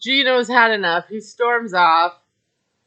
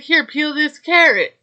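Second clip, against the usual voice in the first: higher and louder, pushy command; mock bossy order; 6 semitones higher, 5 dB louder than usual. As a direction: toss him another chore, bossy. Wife pushes more chores on him.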